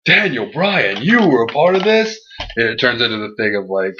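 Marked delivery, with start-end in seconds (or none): 0.1-2.2 shocked outburst. Retold or imitated. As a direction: play it like he can't believe the betrayal. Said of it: "And Triple H's like".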